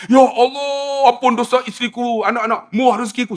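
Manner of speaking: high-pitched wail tumbling into a quick list; wailing plea. Retold or imitated. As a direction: imitate desperate praying to God. Acts man's wailed prayer.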